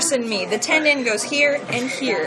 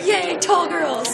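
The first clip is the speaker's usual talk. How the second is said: laughing cheer; 8 semitones higher, much slower than usual. Real, not acted. Cheering tall girls.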